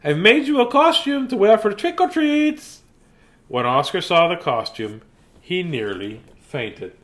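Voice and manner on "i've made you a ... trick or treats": higher and louder sweet mother voice; high gushing mother's greeting; doting mother. A narrator's reading. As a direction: greet your pup like a gushing mom. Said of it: "said Oscar's mother".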